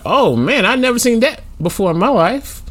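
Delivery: high, stunned disbelief; shocked. Retold or imitated. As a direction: gasp it out in disbelief. Retold shocked thought.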